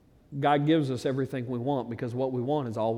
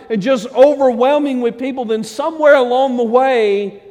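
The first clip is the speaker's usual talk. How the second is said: high, loud lament; preaching at full force; 6 semitones higher, 10 dB louder than usual. Acted. Preacher rebuking the church for faded zeal, loud. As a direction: mourn the lost passion.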